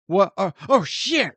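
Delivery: high startled yelp; startled panic. Retold or imitated. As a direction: caught off guard, high and sudden. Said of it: "Murtaugh's still standing there going".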